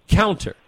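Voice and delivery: one stressed word, Counter, standing by itself; emphasis on counter. Real, not acted. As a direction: hit the word Counter hard and alone. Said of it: "I emphasize the word counter".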